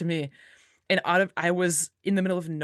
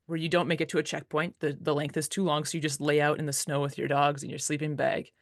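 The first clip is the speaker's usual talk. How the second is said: normal talking voice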